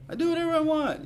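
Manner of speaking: high, petulant mock of a selfish person; a spoiled brat's mock whine; mock whiny selfish voice. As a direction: sneer it like a spoiled brat. Mocking a selfish attitude.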